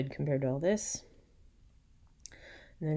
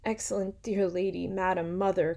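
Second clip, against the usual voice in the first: louder, beseeching address; impassioned cry; 4 semitones higher, 6 dB louder than usual. A narrator's reading. Isabella throws herself at Hippolyta's feet, impassioned cry. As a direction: build the appeal word by word to mother. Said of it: "cried Isabella".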